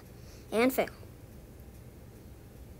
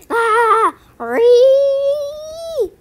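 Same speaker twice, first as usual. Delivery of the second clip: high loud sung screech; hammed-up shrill ree; frustrated screech; 12 semitones higher, 8 dB louder than usual. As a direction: let out a cartoonish shriek.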